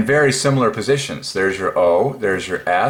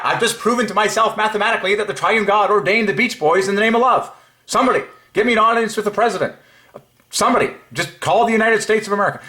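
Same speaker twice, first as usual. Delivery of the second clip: much higher and racing, giddy with triumph; ecstatic; 10 semitones higher, faster than usual. Real, not acted. Vlogger to viewers after his discovery, ecstatic and much higher. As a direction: rush it out like a breakthrough.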